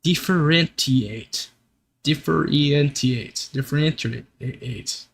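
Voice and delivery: Differentiate said slowly, fading each time, dif-fer-EN-tee-ate; repeated drilling. Shown as a demonstration. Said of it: "that sounds a lot less correct".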